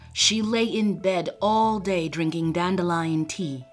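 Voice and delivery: ordinary delivery, nothing marked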